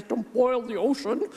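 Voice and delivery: put-on a weak try at a British accent. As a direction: quote it with a bad British accent. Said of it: "I'm very bad at the British accent".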